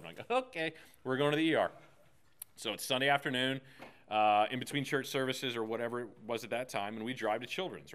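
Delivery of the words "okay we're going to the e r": quick urgent call for the ER. Retold or imitated. Father reacting to his daughter's bleeding head, quick and urgent. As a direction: snap into action, hurried and raised.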